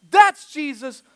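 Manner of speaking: loud, high proclamation; emphatic, emotional. Retold or imitated. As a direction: punch it out as an excited announcement. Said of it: "he's emphatic, emotional".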